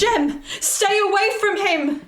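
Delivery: high, urgent warning shout. Acted. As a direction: call out to pull her sister back. Warning her sister, danger.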